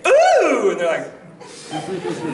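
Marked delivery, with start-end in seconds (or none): 0.1-0.7 very high, loud excited squeal; high excited ooh. Retold or imitated. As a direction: squeal it out with glee. Showing off a new voice.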